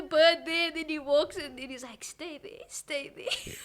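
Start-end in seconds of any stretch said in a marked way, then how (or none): 2.0-3.5 very soft, careful command; hushed film quote. Retold or imitated. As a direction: imitate coaxing it to stay, barely audible. Film character hushing a bird, retold.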